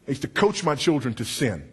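usual speaking voice

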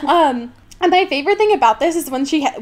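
usual speaking voice

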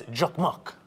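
Jacquemuc with a hard J, as JUCK-muck; corrected pronunciation. Shown as a demonstration. Host correcting co-host's product name, Jacquemuc as JUCK-muck. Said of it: "no, no, it's".

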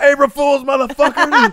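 high, loud gleeful-prankster yell; re-enacted triumphant shout; yelled prank reveal. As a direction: shout it right in the victim's ear. Acts out yelling the prank reveal.

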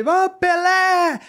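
long high drawn-out call out the car window; high, slow, drawn-out shout of the name. Retold or imitated. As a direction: hail him from the road, stretching the name. Calling out to Pelé statue.

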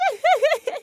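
high, light giggle; gleeful giggle. Acted. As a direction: giggle high after the taunt. Giggle after a taunt.

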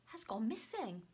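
usual speaking voice

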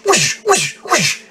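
high airy whoosh imitation; whoosh noise. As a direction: mimic a whooshing sound, high. Describing the icon deleting text.

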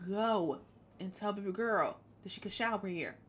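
ordinary talk, nothing marked

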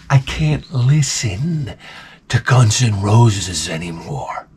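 slow, drawn-out suffering plea; weary dread. Retold or imitated. Comic begging off yet another Guns N' Roses song, weary. Worn out by overplayed band.

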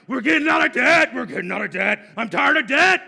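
loud, high determined shout; fierce vow shouted at the debt; aggressive shouting. Pretend. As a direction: yell it like you mean to win. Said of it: "And you're aggressive about it".

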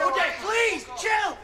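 high, loud plea; pleading shout. Acted. Pleading loudly with Woody to stop fighting, urgent shout. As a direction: yell at him to calm down.

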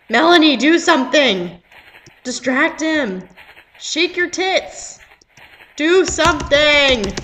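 loud, slow, desperate shouting at the screen; urgent yelling. Real, not acted. Player yelling at Melanie to distract him, loud and slow. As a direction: bark orders at her to act now.

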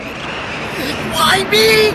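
high squeal. Real, not acted. Caught by monster, squealing.

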